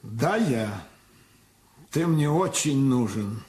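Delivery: usual speaking voice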